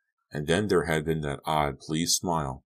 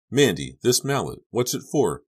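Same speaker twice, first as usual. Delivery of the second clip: alarmed question; 6 semitones higher, 5 dB louder than usual. A narrator's reading. Suspicious question about the mallet.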